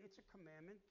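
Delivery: ordinary talk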